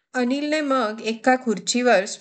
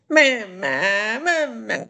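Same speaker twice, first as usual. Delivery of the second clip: wailing tantrum; 5 semitones higher than usual. A narrator's reading. Anil crying in the market, a slow high wail. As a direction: howl it like a toddler crying for a toy. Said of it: "Anil वेडूसारखा जोरजोरात रडायलाच".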